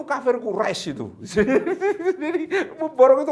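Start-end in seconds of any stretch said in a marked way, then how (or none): 1.7-3.3 high burst of real laughter; laughing. Real, not acted. Preacher laughing at his story's punchline, high burst of laughter. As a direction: laugh out loud at your own joke, high.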